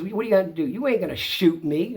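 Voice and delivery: high, loud imitated challenge; mock defiant dare. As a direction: goad like the cocky neighbor. Acts out neighbor daring him.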